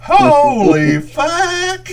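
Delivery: very high, loud, stretched-out squawk; imitated high-voiced guy. Co-host mimicking the video's onlooker, high loud drawl. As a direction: mimic a man with no deep voice, long and high. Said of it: "that don't have the deep voice".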